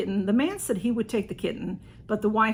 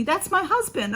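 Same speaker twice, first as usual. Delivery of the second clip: lady's call; 9 semitones higher than usual. Retold or imitated. Lady calling to narrator.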